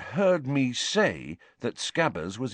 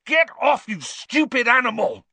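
high, loud outburst; furious yell; 7 dB louder than usual. A narrator's reading. Ron to the cat tearing at his bag, furious yell. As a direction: yell it with full force.

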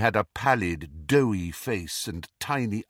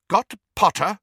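high, loud startled question; pointed, alarmed echo; sharp; 10 semitones higher, 7 dB louder than usual. A narrator's reading. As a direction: query it sharply, taken aback. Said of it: "said Professor McGonagall sharply".

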